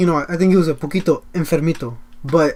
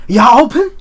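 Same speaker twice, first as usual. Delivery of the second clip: loud, high-rising shout through a closed door; customer calling out; 7 semitones higher, 7 dB louder than usual. Retold or imitated. Customer at the door after closing, calling out loudly. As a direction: call it out hopefully, pitch shooting up.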